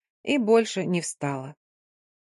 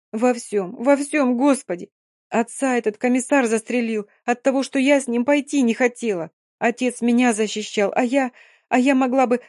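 put-on grieving girl, building to despair; 4 semitones higher than usual. Dina blames herself, distraught.

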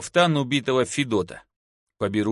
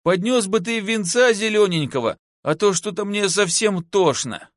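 high-pitched wheedling plea; coaxing, plaintive beggar woman; pleading; 7 semitones higher than usual. A narrator's reading. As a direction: beg pitifully for a drink. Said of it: "умоляюще попросила она".

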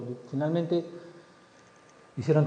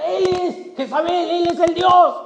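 high, loud, excited voice; excited retelling; 12 semitones higher, 7 dB louder, slower than usual. Acted. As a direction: blurt it out thrilled. Ahab telling wife excitedly.

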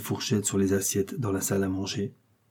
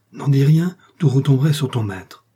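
louder, raised-pitch urgent order; urgent warning; 4 semitones higher, 6 dB louder than usual. A narrator's reading. Emma silences the desperate Justin, urgent warning. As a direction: warn them forcefully to keep silent.

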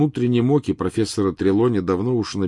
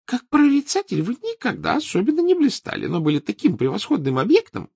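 Trelawney voice; 4 dB louder than usual. Acted.

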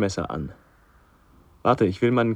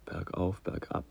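dazed murmur; 4 semitones lower, 13 dB quieter than usual. Acted.

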